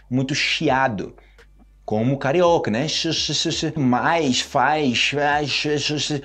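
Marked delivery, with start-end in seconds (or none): 2.9-6.2 hissing sh sounds. Shown as a demonstration. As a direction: hiss out shh like a carioca. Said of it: "Fala muito chiado".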